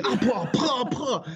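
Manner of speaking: high cries punctuated by popped thuds; mock impact noises. Storyteller butted by teenage dolphins, imitated hits and cries. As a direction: vocalize each blow as it lands. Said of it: "start coming up and butting me".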